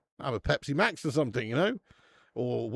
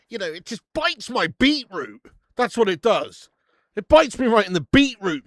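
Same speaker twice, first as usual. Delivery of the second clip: excited outburst; 7 semitones higher, 10 dB louder than usual. Real, not acted. Raving about his favourite beer.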